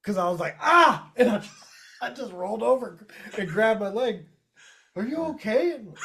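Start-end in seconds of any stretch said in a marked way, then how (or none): 0.6-1.1 sudden loud, high yell; startling pain cry. Retold or imitated. Man retelling the cramp that scared a girl, loud pained yell. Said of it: "I scared a girl". 4.9-5.7 higher-pitched retold worry; lightly voiced worried girl. Retelling the scared girl's reaction to his cramp, higher worried imitation.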